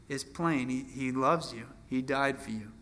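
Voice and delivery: usual speaking voice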